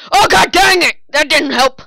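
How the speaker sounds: high, loud exasperated yell; frustrated shout. Real, not acted. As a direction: yell as the juke fails. Juke fails in chase.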